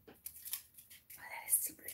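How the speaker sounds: soft delighted whisper. Real, not acted. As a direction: hush it like a secret. Admiring the gift hat.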